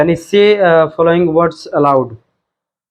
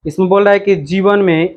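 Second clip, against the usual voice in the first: usual speaking voice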